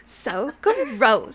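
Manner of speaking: put-on drawn-out Valley Girl; sulky Valley Girl gross; Valley Girl accent. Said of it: "Valley Girl accent".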